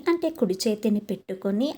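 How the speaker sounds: usual speaking voice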